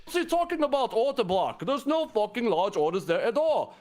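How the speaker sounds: high, exasperated mock English accent; a terrible English accent, ranting; bad English accent. Voicing Dante's despair about the order block, in a bad English accent. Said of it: "terrible doing an English voice".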